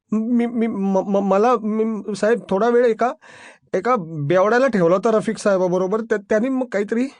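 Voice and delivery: high-pitched, faltering excuse; frightened stammer. Acted. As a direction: plead nervously, tripping over words. Terrified subordinate stammers an explanation. (घाबरून तोतरत खुलासा)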